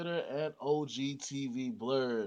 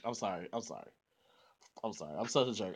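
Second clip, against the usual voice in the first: quiet apology; 8 semitones lower, 8 dB quieter, much faster than usual. Acted. Mock apology after teasing.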